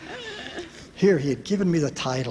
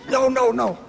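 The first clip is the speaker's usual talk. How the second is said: high, louder desperate no; frantic no; 9 semitones higher, 4 dB louder than usual. Retold or imitated. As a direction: wave her off in a panic. Husband frantically signals wife.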